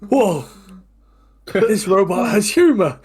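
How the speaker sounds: high, loud delighted gasp at the robot; a thrilled "whoa", way up high; amazed. Acted. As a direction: shout it in sheer wonder. GM voices amazed character reaction.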